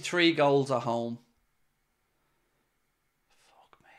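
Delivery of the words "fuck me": breathy disbelieving swear, barely voiced; whispered curse. Real, not acted. Fan stunned at conceding whispers a curse. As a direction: mutter it in disbelief.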